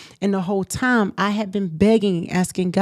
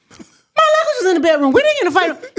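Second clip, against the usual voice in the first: ordinary talk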